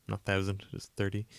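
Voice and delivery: chuckling through the words; amused, half laughing. Real, not acted. Blog views dropping to thirty.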